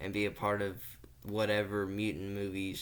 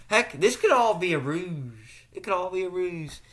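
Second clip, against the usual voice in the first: high excited voice; 8 semitones higher, 8 dB louder than usual. Acted. Conspiratorial speculation, high pitch.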